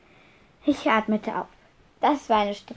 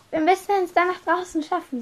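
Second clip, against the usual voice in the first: urgent; 8 semitones higher, 4 dB louder than usual. Acted. Fleeing the thestral herd.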